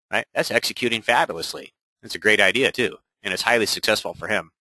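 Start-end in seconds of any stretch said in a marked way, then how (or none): none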